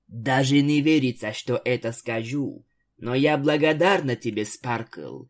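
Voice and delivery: plain reading voice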